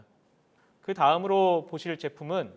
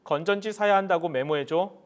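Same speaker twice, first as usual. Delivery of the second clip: talking to voice assistant; 4 semitones higher, 6 dB louder than usual. Real, not acted. Commanding smart speaker.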